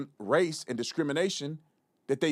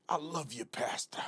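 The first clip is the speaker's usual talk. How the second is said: much softer mock-pastor voice, trailing to breath; hushed, put-on churchly devotion; fake pastor; 9 dB quieter than usual. Mocking pastors greeting each other in church, hushed fake-pastor voice. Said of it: "they have a pastor persona".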